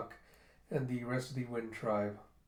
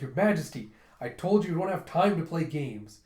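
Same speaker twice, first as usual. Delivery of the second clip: loud, exasperated reproach; mild scolding; 3 semitones higher, 6 dB louder than usual. Retold or imitated. Judo reproaching the king about games.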